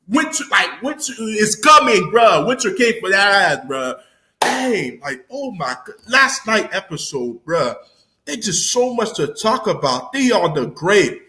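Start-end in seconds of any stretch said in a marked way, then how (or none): none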